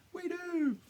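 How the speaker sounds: very high, drawn-out mock cheer; eager crowd response. Believers answering the leader, high mock enthusiasm. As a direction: imitate a crowd chorusing back.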